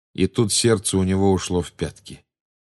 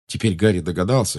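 plain reading voice

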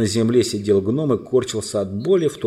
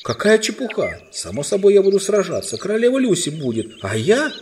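put-on high, loud boyish protest; indignant cry; 10 semitones higher, 4 dB louder than usual. A narrator's reading. Prince protests being kept from battle, indignant cry. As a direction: protest hotly, pitch way up. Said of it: "Но Корин вскричал".

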